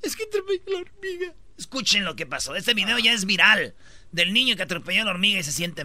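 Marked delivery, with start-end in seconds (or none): 0.0-1.3 much higher mock sobbing child voice; imitating the crying boy. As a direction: whimper it like a small boy. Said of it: "A ver, ¿cómo?". (imitando al niño llorando)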